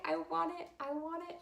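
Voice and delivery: high giddy repeat; giddy wanting. Retold or imitated. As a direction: beg it high and eager. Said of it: "I'm thinking".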